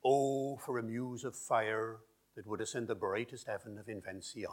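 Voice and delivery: Shakespeare in OP with the R sounded after vowels; original pronunciation. Shown as a demonstration. Linguist answers the RP reading in original pronunciation. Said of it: "an example of R P versus O P".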